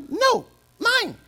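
put-on high whiny little-kid voice; whiny child. Preacher voicing a toddler refusing to share, whiny and high. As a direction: whine it like a toddler guarding a toy.

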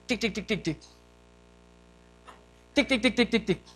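soft rapid dead-starter ticks; engine clicking imitation. Preacher mimics brother cranking the dead boat engine, soft ticking. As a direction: imitate a motor that won't turn over. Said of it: "he cranks it on and goes".

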